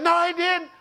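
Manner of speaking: high-pitched mock disbelief; retold indignant denial. Spouse objecting during counseling, high indignant denial. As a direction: pipe up in sharp contradiction.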